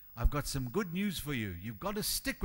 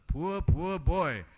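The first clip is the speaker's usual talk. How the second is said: mock pity; 4 dB louder, much slower than usual. Mock sympathy for billionaire.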